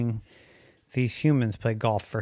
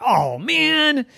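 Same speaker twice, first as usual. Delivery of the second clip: frustrated; 12 semitones higher, 4 dB louder than usual. Acted. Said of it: "I sound just like those humans".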